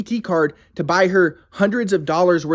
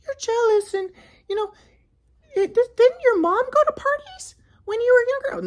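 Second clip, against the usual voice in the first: high falsetto, accusing; mocking his ex. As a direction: needle him in a high put-on voice. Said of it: "And she's like".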